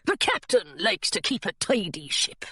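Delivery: pirate mate politely giving an order; pirate deckhand. Acted.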